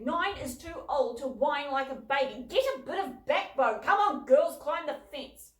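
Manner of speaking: loud, firm telling-off; scolding father. A narrator's reading. Father scolding his daughters to climb the fence, loud and firm. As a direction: push the kids to stop being babies.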